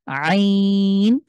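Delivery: very slow আইন squeezed from mid-throat; throaty ain. Shown as a demonstration. Teacher slowly demonstrates আইন for students. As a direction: drag out আইন pressing the throat. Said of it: "আবার try করেন".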